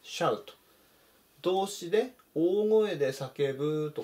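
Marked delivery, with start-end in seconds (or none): 0.0-0.4 shout, not starting ショー; シャウと読むshout; model pronunciation. Shown as a demonstration. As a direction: say shout with シャウ sound. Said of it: "ショーっていうふうに読み始めないようにしてください".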